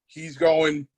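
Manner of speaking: ordinary talk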